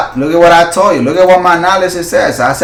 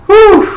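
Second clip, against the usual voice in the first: high, loud whoo of delight; real joyful whoop; excited whoop; 6 dB louder than usual. Real, not acted. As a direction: let out a thrilled whistle-like whew. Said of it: "I get super, super excited".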